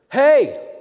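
sharp loud call; shout. Retold or imitated. Stranger calling the pastor back, loud shout. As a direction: bark it out to stop him.

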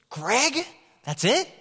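high, loud disbelieving Greg; mock outrage at a plain name; incredulous. Homeless lady to the pastor, loud incredulous. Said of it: "She goes".

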